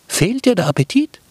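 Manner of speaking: Brotkäppchen asking the wolf kindly, high; caring girl. Acted.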